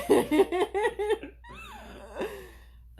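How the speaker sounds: long high falling laugh. Real, not acted. Owner laughing at the cat's antics, high laugh. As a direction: let a high laugh tumble down.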